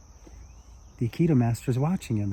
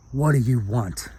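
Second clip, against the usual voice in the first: belligerent drunk, only lightly acted. Drunk planting his feet before the small man, mildly belligerent. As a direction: challenge him with mild belligerence. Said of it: "the belligerent man".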